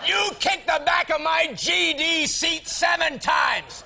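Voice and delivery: high, slow mock-furious shout; retold angry yell. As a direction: imitate an enraged passenger, drawing it out. Retold quarrel, Lucy explodes.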